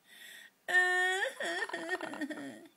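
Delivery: high, drawn-out fake wail breaking into sobs; mock crying. Shown as a demonstration. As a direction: demonstrate a pretend cry for the parrot. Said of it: "Cry for Mummy".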